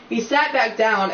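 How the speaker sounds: ordinary delivery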